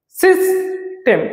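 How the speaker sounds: সিস্টেম broken by a clear pause, সিস ... টেম; syllable by syllable. Shown as a demonstration. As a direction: pronounce সিস্টেম slowly as two separate syllables. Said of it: "দুই ভাগে আমরা ভাগ করে". (দুই ভাগে থেমে থেমে সিস্টেম)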